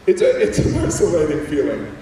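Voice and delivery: ordinary talk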